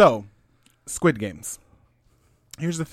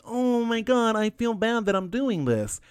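higher, whiny-contestant imitation; mock regret; 6 semitones higher, slower than usual. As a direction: lightly mimic someone's sudden guilty conscience. Mocking a regretful game contestant.

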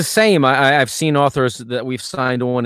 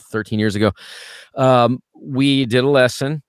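ordinary delivery

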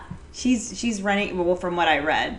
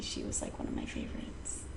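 whispered; 17 dB quieter than usual. Real, not acted. Reluctant admission.

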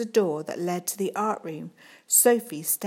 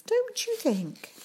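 sugary question; slower than usual. Acted. Teacher charming the head.

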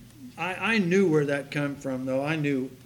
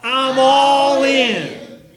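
very slow, much louder, laughing; loud rally call; 8 semitones higher, 13 dB louder, much slower than usual. Shown as a demonstration. Pastor leading congregation in a loud chant. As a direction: belt it out to the crowd. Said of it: "one more time".

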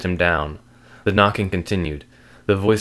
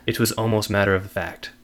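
usual speaking voice

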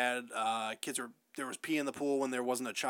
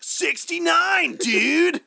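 high, slow, laughing shout; excited shout; 12 semitones higher, 9 dB louder, much slower than usual. Retold or imitated. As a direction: yell it out gleefully, grinning. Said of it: "and then he's just like".

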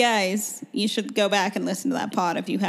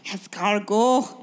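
slow fake French, rising tune; French chef; much slower than usual. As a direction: echo it slowly like a French chef. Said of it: "this episode's accent".

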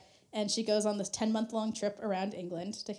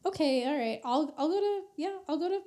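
mock sheepish agreement; 7 semitones higher than usual. Queen agrees to the errand.

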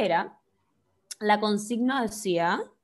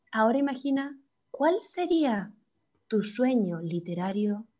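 mock meditation voice. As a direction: draw it out like a meditation guide. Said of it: "Voy a hacer una voz distinta".

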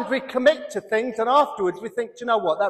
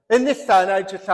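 ordinary talk, nothing marked

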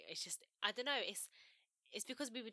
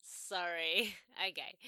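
ordinary talk

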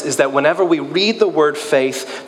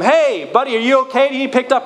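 calling out; 10 semitones higher, faster than usual. Retold or imitated. Boat crew calling out to the stranded man. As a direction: call out to a man on an island. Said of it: "they call out to him".

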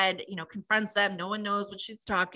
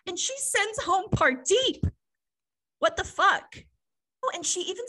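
higher, louder, incredulous outburst; shocked outrage; 11 semitones higher, 3 dB louder than usual. Real, not acted. Host reacting to Pardeep sent home, shocked and loud. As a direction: blurt it in disbelief.

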